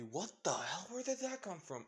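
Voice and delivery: high-pitched incredulous outburst; baffled reaction. Retold or imitated. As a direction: imitate a stunned audience, pitch swooping up. Imagined viewers' baffled reaction.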